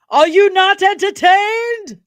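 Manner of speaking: high, drawn-out arena bellow; shouted challenge. Acted. Streamer quoting a film to viewers, slow high shout. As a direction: roar the question slowly to a whole crowd.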